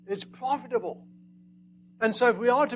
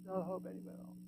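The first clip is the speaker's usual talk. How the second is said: No hope anywhere else repeated much softer, almost hushed; soft echo; 15 dB quieter, faster than usual. Shown as a demonstration. Preacher echoing his point to the congregation, hushed. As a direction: let the words sink in quietly. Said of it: "by the fact that there was no hope anywhere else".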